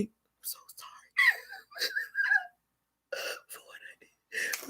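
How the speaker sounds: very soft whispered mock sobbing; fake crying. Mimicking someone faking tears to be forgiven, fake crying. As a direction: whisper a pretend tearful apology. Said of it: "Don't be fake crying".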